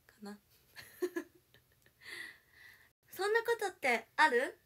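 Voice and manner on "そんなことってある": very high, loud, slow cutesy whine; fake cute voice. Shown as a demonstration. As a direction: go all out cute and pouty. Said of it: "高い、高い。".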